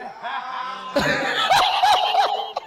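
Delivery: squeaky high giggle; rising giggle fit; high laugh. Real, not acted. Laughing at own joke.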